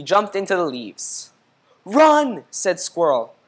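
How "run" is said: very high, loud run; urgent shout. A narrator's reading. Squirrel warning Pinwheel to flee, urgent shout. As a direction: cry out in alarm.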